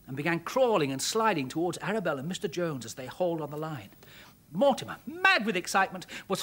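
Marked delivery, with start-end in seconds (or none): none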